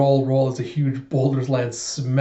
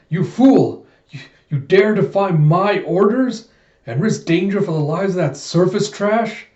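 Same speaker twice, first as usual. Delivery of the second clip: loud, higher-pitched acted rage; angry outburst; 6 semitones higher, 5 dB louder than usual. Bigot getting angry at Alita for defying orders, angry outburst. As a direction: berate a defiant underling at full voice. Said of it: "getting angry at Alita".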